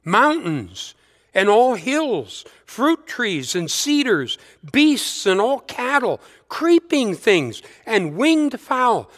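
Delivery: slow, lifted psalm reading, item by item; scripture reading. Acted. Preacher reading the psalm list slowly to the congregation. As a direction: savour each pair of the psalm's list slowly.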